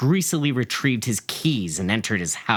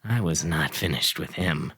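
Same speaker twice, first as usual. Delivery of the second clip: usual speaking voice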